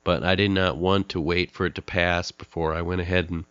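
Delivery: ordinary talk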